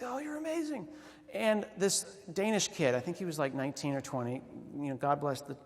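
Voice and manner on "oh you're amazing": mock gushing. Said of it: "we're like".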